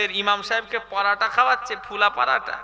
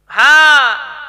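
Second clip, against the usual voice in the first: drawn-out shout; 4 semitones higher, 15 dB louder, much slower than usual. Real, not acted. Preacher calls to the crowd.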